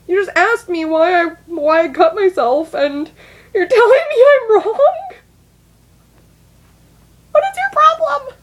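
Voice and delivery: put-on a high, furious teenage retort; indignant yell. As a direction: yell back at them in disbelief. Retold indignant outburst, voices self.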